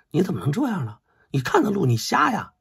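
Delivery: high-pitched indignant scolding of a stranger; angry retort. Retold or imitated. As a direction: snap back like an insulted passer-by. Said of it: "那这个对方非常生气了". (被撞路人尖声怒斥)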